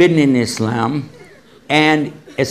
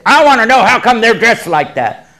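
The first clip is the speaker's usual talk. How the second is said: rude demand; 8 semitones higher, 6 dB louder than usual. Retold or imitated. Older woman confronts the speaker.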